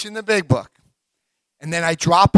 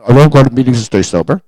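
louder, declared for the whole room; 6 semitones lower, 12 dB louder, faster than usual. Shown as a demonstration. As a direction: proclaim it boldly so the recording catches it. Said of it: "I'll say that out loud".

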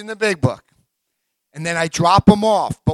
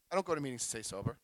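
fast, much softer, tossed off under the breath; half-hidden quiet confession; muttered aside; 6 semitones lower, 17 dB quieter, much faster than usual. Shown as a demonstration. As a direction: slip it in quickly and quietly. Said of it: "I'll say that out loud".

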